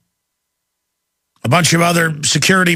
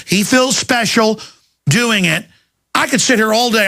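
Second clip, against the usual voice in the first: ordinary talk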